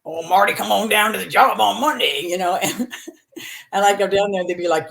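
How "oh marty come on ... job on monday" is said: put-on good old boy call, a bit louder and livelier. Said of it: "these good old boys would be on there".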